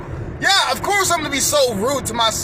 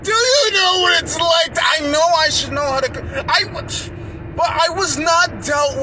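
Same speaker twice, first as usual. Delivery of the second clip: desperate shouting; 6 semitones higher than usual. Acted. Speaker pleading with the judge, desperate high shouts.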